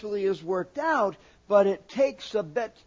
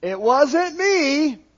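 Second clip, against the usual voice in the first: slow, higher and louder "it wasn't me"; childish excuse; 6 semitones higher, 7 dB louder, slower than usual. Retold or imitated. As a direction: whine it like a kid denying blame. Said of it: "a whole lot of".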